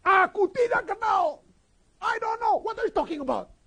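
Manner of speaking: loud, high-pitched protesting shout; heated denial. Acted. Acting Peter's heated denial. (teriakan menyangkal dengan nada tinggi)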